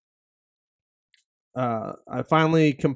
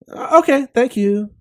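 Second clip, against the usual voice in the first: put-on high, bright girlish voice for the daughter; imitating his daughter; 9 semitones higher, 5 dB louder than usual. Father mimics his daughter thanking him in a high voice. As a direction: squeak it out like a sheepish kid. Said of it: "That's what she says".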